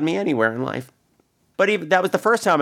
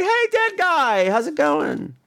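high, slow drawn-out mock greeting; imitating cast teasing; 12 semitones higher, much slower than usual. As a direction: stretch the hey way up like a teasing coworker. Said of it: "they'd be like".

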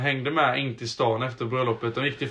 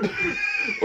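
burst of laughter. Real, not acted. Laughing after shouted quote.

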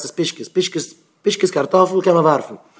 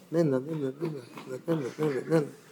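a soft, distant ding ding, much quieter; imitated faint doorbell; 14 dB quieter than usual. To his wife, mimicking the faint doorbell, quiet and distant. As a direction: ring the bell far away, barely audible.

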